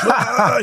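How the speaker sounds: laughing mock yell. Mock shout before heavy lift.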